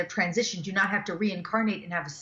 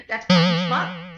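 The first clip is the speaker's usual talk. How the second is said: louder, slow, blunt judgment; mock outraged remark; 7 dB louder, slower than usual. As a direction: spit it out like a shocked onlooker. Retelling what a judging translator says.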